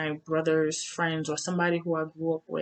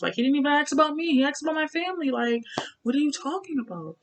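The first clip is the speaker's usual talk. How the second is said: fast, higher, louder exasperated argument; heated protest; 9 semitones higher, 6 dB louder, much faster than usual. Retold or imitated. Woman answers her accusing partner, heated and exasperated. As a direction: fire back at a false accusation, indignant.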